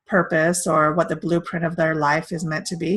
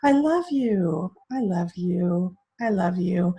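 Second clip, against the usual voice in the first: varied inflections; slower than usual. Retold or imitated. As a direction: play with the tune of each I love you, slowly. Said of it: "he does all these different inflections like".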